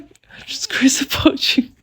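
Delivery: giggly whispered imitation of a lover; hushed, swooning mock whisper; whispered confession. Retold confession after a kiss.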